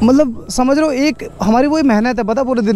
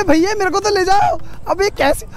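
shouting after the departing bike, voice cracking high; calling after her; 9 semitones higher than usual. Real, not acted. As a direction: yell to stop her as she rides off. Bike rider leaves him behind.

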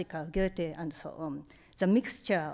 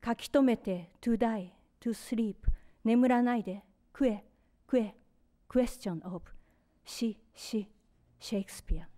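poetry reading; 4 semitones higher, faster than usual. Acted. Reads her poem aloud.

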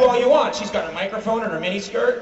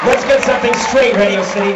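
louder rapper hype voice; a hammed-up rapper shout-out; 3 semitones higher, 5 dB louder than usual. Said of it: "That was my impression of rapper [name]".

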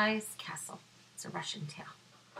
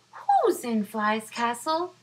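bunny calling out; 4 semitones higher, 8 dB louder than usual. Acted. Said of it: "Bunny calls out".

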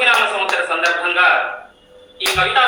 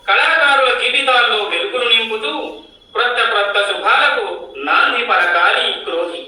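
higher, measured poetic declamation; poem recitation; 4 semitones higher than usual. Acted. Poet reciting a new-year Ugadi poem to the gathering, poetic recitation. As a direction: recite it line by line with a lilting rise and fall.

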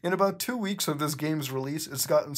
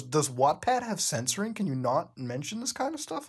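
normal talking voice

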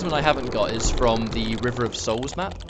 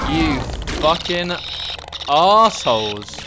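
each word spat out louder, last word slow and drawn out; angry shout; 3 semitones higher, 3 dB louder, slower than usual. Real, not acted. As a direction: yell it at the enemies, word by word. Repeated curse, now shouted.

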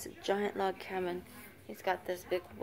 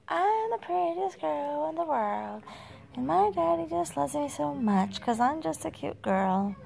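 put-on higher, sweet little-girl voice; voicing the baby; 7 semitones higher, 4 dB louder than usual.

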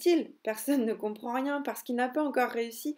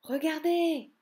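higher, louder, slowed wonder; excited exclamation; 6 semitones higher, 4 dB louder, slower than usual. Acted. Nick showing his siblings red mushrooms, excited. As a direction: point out the mushrooms in delight. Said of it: "s'exclame Nick".

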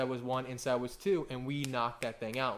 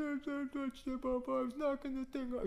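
put-on little boy confessing; 10 semitones higher, 5 dB quieter than usual. Four-year-old son confessing to his papa, small and meek.

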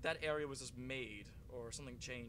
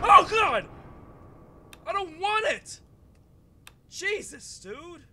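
loud, much higher shrieks of fright; terrified screaming; 22 dB louder, much slower than usual. Real, not acted. Gamer hit by a jump scare, terrified screams. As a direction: scream as the monster jumps out.

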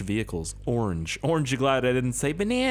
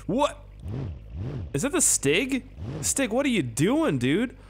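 much higher, startled excitement; excited squeak; 4 semitones higher, slower than usual. Real, not acted. Spotting the Stig in the car, high excited squeak. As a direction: voice shoots up in real surprise. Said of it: "Why did my voice go so high?".

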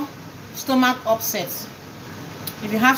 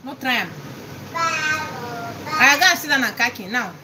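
normal talking voice

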